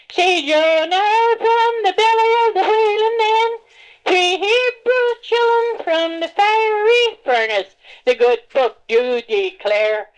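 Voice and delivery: high, loud fervent preacher's prayer; hammed-up backwoods preacher pleading; preacher praying loud. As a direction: cry out to the Lord like a revival preacher. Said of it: "this is what he'd say".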